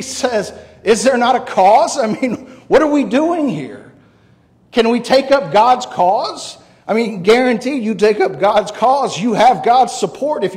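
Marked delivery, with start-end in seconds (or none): 0.8-6.6 raised, higher rallying cry; David. Acted. David urging Israel to take up God's cause, impassioned challenge. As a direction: challenge them with forceful, high-pitched questions.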